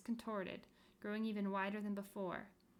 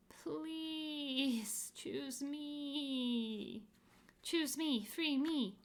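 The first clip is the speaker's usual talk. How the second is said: pleading; 5 semitones higher, 3 dB louder, much slower than usual. Acted. Faces plead clamorously.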